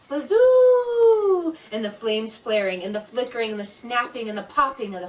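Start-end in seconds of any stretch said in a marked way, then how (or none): 0.1-1.6 very high, loud, drawn-out wind howl. Acted. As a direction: moan like a gale through the trees. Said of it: "the wind blowing".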